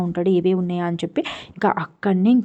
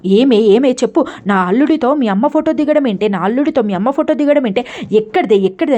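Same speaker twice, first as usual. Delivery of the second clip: loud, high scolding yell; furious retold mother-in-law yell; angry shouting; 4 semitones higher, 5 dB louder than usual. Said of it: "చాలా గట్టి గట్టిగా అరుస్తుంది".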